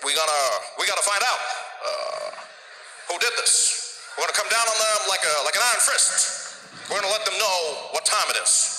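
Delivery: halting presidential impression; Barack Obama. President promising to find who shot the plane, halting impression. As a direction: imitate a president vowing tough action, with pauses. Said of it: "Barack Obama, he was there, he's like".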